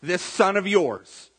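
loud, slow, pointed disowning; resentful brother. Acted. Angry brother disowning his sibling to his father, loud and resentful. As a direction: lean hard on each word, acting aggrieved. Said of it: "that's what we see him doing".

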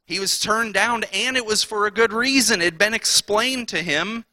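usual speaking voice

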